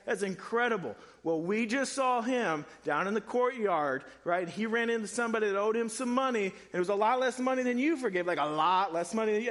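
gossiping servants, wide-eyed and thrilled; excited servants. Retold or imitated. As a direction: servants bursting to tell the king the news. Servants report to the king.

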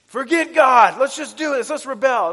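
high, loud rallying cry; over-the-top open defiance, imitated; mock rebel shout. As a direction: shout it like a hammed-up call to revolt. Mock open rebellion, not Satan's way.

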